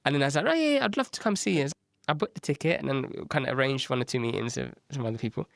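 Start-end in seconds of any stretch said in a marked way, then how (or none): 0.5-1.6 high, louder eager gush; hammed-up enthusiasm. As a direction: jump at the invitation. Eager pretend reply.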